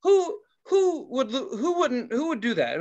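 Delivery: high, loud disbelief easing down; incredulous. Retold or imitated. Teller thinking about angry guests, hooted incredulity. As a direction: hoot the question in amused disbelief. Acting his incredulous thoughts.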